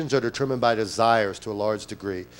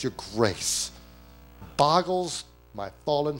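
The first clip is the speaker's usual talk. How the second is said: usual speaking voice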